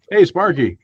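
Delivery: high, loud hail to a teammate; calling out. Acted. Player hailing Sparky for help, calling out. In-character call to another player's character.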